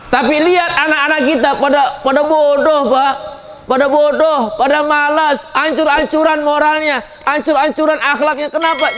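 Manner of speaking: high, loud, impassioned sermon voice; fervent preaching. Acted. Preacher lamenting children far from the Quran, impassioned loud sermon. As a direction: lament it loudly to the hall, slowing on the ends.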